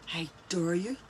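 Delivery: soft, slow mock gasp; mock outrage. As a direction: feign shocked outrage.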